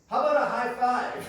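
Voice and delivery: loud, high, upbeat dodge; chirpy counter-offer. Retold or imitated. Imitating sister dodging a marriage proposal, chirpy and loud. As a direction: offer it brightly as the punchline.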